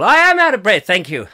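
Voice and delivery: emphatic admission. Real, not acted. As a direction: proclaim it, leaning hard on "am". Confirms remark with mock indignation.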